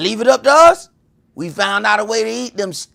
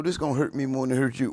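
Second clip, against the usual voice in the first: a hint of mom, lower, fast and soft; lightly voiced mother before the whooping; imitating mom; 7 semitones lower, 6 dB quieter, much faster than usual. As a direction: mutter mom's old line quickly. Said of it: "the pre-whooping talk".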